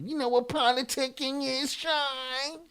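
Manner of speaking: high, sing-song mock voice; mocking imitation. Mimics the condescending chatter.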